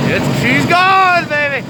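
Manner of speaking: high, slow, loud triumphant call. Real, not acted. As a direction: celebrate the engine catching. Engine just started.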